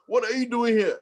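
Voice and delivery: high, loud imitation of a shocked shout; retold scream. Student to his professor at the club door, mock scream. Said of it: "he screams his head up".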